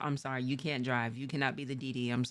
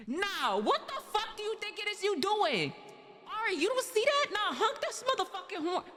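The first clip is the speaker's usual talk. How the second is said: mock road-rage friend; 14 semitones higher than usual. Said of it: "they're just like".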